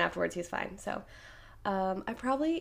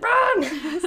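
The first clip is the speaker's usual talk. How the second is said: high, loud mock shout; urgent call; 9 semitones higher, 8 dB louder than usual. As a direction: yell it like an escape, half laughing. Retold escape from preschool.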